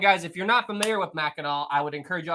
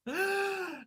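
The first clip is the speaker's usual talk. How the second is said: high, soft tail of laughter; helpless laugh; 10 dB quieter than usual. Real, not acted. Streamer recovering from chat's replies, high soft laugh. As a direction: squeal softly with leftover laughter.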